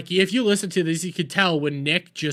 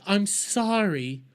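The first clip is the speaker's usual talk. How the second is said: slow, higher, pleading sorry voice; hammed-up slow apology, pitched up; mock grovelling apology; 5 semitones higher than usual. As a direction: whine each sorry slowly like a guilty kid. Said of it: "expecting Nick to come in and go like".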